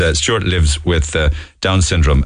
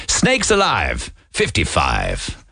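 bingo caller; 4 dB quieter, much slower than usual. Pretend. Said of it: "I wouldn't mind doing a bingo call".

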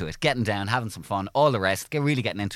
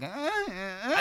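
awkward high-pitched grunts, cut off; hammed-up high, quiet moaning; high strained moans; 12 semitones higher, 8 dB quieter, much slower than usual. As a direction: mimic awkward bedroom moans, high and tight. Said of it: "And I'm just like".

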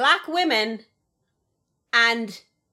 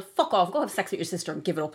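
ordinary talk

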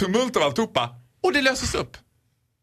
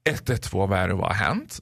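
low, tired operator voice; weary operator; 9 semitones lower than usual. Retold or imitated. Teller imitating a tired emergency operator, lightly voiced. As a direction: answer like a bored dispatcher. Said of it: "Hon svarar så här".

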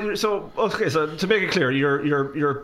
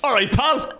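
mock bloke greeting; 7 semitones higher than usual.

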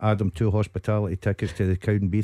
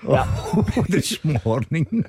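laughing; 5 semitones higher, much slower than usual. Real, not acted. Teasing echo of Callum.

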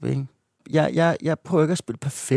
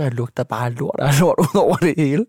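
laughing while telling; 3 semitones higher, 5 dB louder than usual. Real, not acted. Laughs while telling own mishap.

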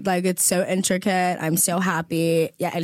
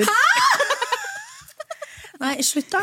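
much higher shouted laugh; shocked laugh; much slower than usual. Real, not acted. Co-host hears her stand-up date, shocked laughing yelp.

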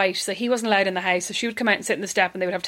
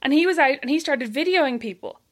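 usual speaking voice

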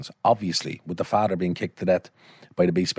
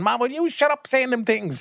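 very high-pitched mock telling-off; scandalised scolding. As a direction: imitate a mortified daughter scolding her mother. Daughter scolds her mother, retold.

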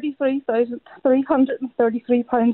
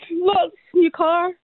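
normal talking voice

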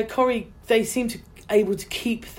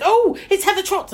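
excited squeal; 12 semitones higher, 5 dB louder than usual. Retold or imitated. Woman excited recognising her.

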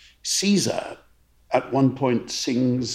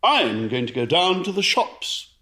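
lively, sing-song; higher, bouncy and animated; 6 semitones higher than usual. Shown as a demonstration. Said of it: "Well, I could say".

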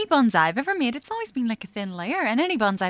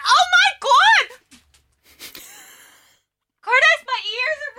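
laughing shriek; 8 dB louder than usual. Real, not acted. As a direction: wail it through laughter. Chili burning her mouth.

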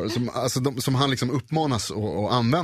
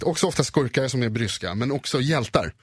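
normal talking voice

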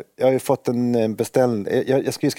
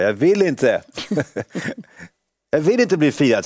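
mock yell, higher and louder; mother screaming refusal from her room; retold scream; 7 semitones higher, 3 dB louder than usual. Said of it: "Då skrek hon bara". (hånfullt härmat skrik)

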